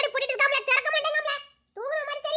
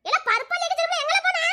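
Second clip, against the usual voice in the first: calling out; 7 semitones higher than usual. Acted. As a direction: shout the son's name across the house. Mother calling out for son.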